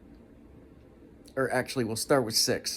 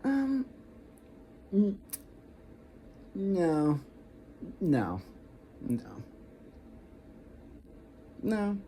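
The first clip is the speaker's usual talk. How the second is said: weak no; 8 semitones higher, 6 dB quieter, much slower than usual. Shown as a demonstration. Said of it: "a weak no".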